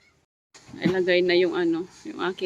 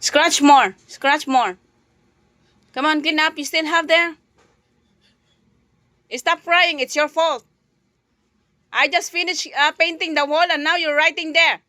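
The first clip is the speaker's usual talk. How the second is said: angry scolding; 6 semitones higher, 6 dB louder than usual. Real, not acted. Mother scolding her daughter for drawing on the wall, loud and angry.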